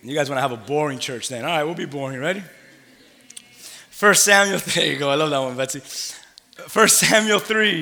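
ordinary delivery

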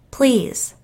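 high plea; pleading. A narrator's reading. Jill pleading with the narrator, high and desperate. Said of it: "Jill pleaded".

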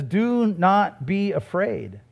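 slow, weighty "Do not be afraid"; solemn quotation. Acted. Preacher quotes Jesus' words to Peter, solemn quotation. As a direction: give each word its own weight.

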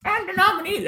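squeaky high cartoon duck; Donald Duck voice. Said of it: "imagine Donald Duck going up and going like".

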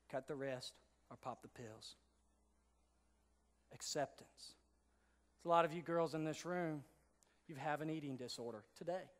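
low, hushed, grave delivery; somber preaching. Acted. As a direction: bring the room down to a quiet, heavy tone.

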